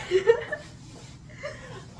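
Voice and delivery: high giddy laugh. Real, not acted. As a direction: laugh it out, flustered. Laughs after squeal.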